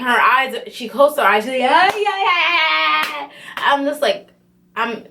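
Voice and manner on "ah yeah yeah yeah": high, long-held mock wail; retold yelling. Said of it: "She's still yelling and clapping".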